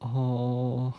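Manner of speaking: ordinary talk, nothing marked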